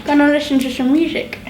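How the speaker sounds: talking around a pencil in teeth; pencil in mouth. Real, not acted. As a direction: mumble with a pencil clenched. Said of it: "with the pencil in my mouth".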